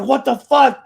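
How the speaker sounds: loud stunned outburst; shocked what the fuck. Retold or imitated. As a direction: blurt it out in disbelief. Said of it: "I was like".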